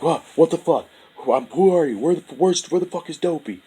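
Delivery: high frantic shouting, voice cracking up; panicked yelling. Retold or imitated. Panicked yelling at the man in his face. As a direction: yell in total panic at the stranger.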